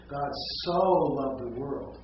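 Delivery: SO stretched long and loud; stress on SO. Shown as a demonstration. Congregant shows how "so" misleads, long swelling stress. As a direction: blow up the word "so" as if measuring size. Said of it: "it's like this big".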